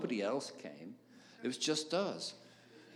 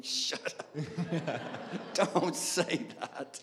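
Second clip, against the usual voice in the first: inner warning, breaking into laughter; laughing inner voice; 5 semitones higher, much slower than usual. Retold or imitated. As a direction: scold himself while cracking up. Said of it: "my head was going".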